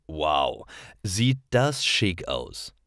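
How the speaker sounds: normal talking voice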